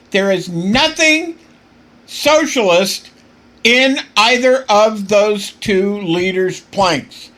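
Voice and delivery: slow, loud, worked-up emphasis; getting excited. Real, not acted. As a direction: hammer each word out slowly, fired up. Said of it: "I'm getting a little excited here".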